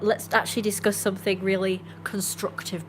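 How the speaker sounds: usual speaking voice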